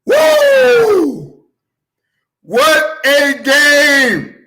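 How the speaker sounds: high, loud, slow what a game; hyped shout. Real, not acted. Host overexcited about playoff games, shouting. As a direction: shout it like the game blew you away. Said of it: "I'm extra loud right now".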